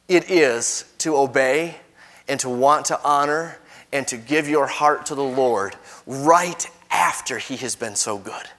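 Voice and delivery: ordinary delivery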